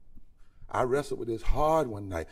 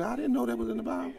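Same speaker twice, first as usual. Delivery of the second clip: surprised reader; 10 semitones higher, faster than usual. Retold or imitated. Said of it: "You're gonna say".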